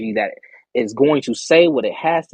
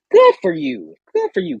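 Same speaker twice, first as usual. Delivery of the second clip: sighing praise; 13 semitones higher, 5 dB louder than usual. Real, not acted. High, slow, sighed praise.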